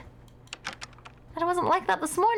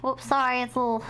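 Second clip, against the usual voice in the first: normal talking voice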